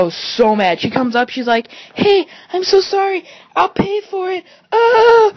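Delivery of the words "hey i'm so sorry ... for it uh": high, squeaky pleading friend; mock pleading apology. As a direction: beg forgiveness in a pitched-up voice.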